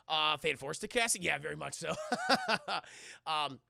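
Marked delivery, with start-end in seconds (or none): none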